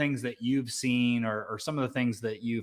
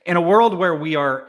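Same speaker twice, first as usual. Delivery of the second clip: epic trailer announcer, higher and louder; movie trailer voice; 7 semitones higher, 10 dB louder than usual. Shown as a demonstration. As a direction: boom it out like a blockbuster trailer. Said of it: "That's my trailer voice".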